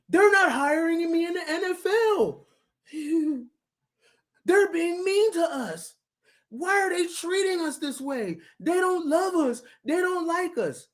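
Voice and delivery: high, whiny mock crybaby; baby minority talk. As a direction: whimper it like a pouting child. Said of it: "That's baby minority talk".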